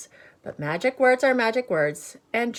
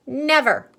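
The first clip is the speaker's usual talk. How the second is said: loud high flat-out refusal; stubborn refusal; 7 semitones higher, 7 dB louder than usual. Acted. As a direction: stamp your foot on it. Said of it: "cried Annalisa".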